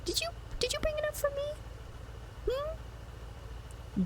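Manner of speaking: tiny, very high pleading voice; playful begging. Pretend. Asking a viewer to share the stir fry, playful begging. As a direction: squeak it out small and hopeful.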